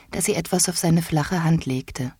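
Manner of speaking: ordinary delivery, nothing marked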